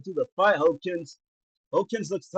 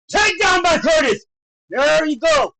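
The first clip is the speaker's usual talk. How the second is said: raised, higher play-by-play voice; excited call; 6 semitones higher, 4 dB louder than usual. Real, not acted. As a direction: cheer the takedown on. Calling a takedown live.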